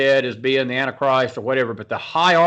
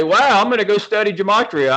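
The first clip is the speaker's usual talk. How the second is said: higher and louder, a hammed-up eureka; mock excitement; 7 semitones higher, 4 dB louder than usual. Host teases guest with a mock excited wake-up line. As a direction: play the sudden convert with a light, gushing wow. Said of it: "didn't wake up one morning and say".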